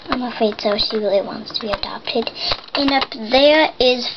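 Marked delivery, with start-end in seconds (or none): 0.1-2.3 soft pitying whisper. Pretend. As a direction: whisper it sadly. Whispered pity for the pet.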